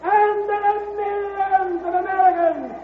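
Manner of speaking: floor speech. Acted. George addresses the convention against Vandergrift, rising oratory. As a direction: declaim it to the whole hall.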